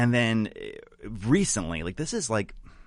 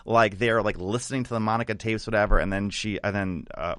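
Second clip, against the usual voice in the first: usual speaking voice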